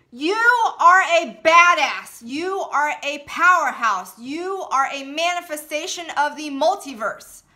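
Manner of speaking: full-voiced shout from the gut; bellowed affirmations; loud yell. Shown as a demonstration. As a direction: yell it out with all your power. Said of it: "I'm about to raise my voice and say this very loudly".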